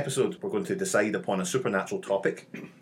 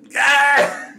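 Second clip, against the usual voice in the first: excited cheer; 12 dB louder than usual. Real, not acted. Hyped show intro cheer.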